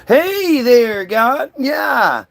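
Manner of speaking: high, bright sales pitch greeting; salesman voice. Retold or imitated. Salesman Paul greeting God on the phone, high and eager. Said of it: "You gotta be like".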